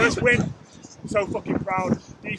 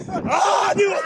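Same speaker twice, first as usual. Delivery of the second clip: higher and louder, real joy; excited shout; 9 semitones higher, 7 dB louder than usual. Real, not acted. Head coach shouting with joy to his players after the win. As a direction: burst out with delight.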